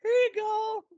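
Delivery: high, loud mock handover; mock-generous offer. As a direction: hand it over like a big favor, hammed-up. Mock advice, acted favor giver.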